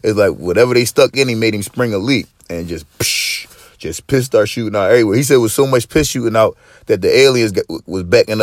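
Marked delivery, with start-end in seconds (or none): 3.0-3.5 sharp hissing burst; quick "psh" of spraying liquid; spurting liquid noise. Retold or imitated. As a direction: imitate a leak springing.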